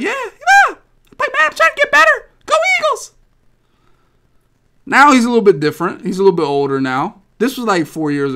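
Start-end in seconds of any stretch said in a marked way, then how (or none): 0.0-3.1 kid voice imitation. Imitating young Salty answering.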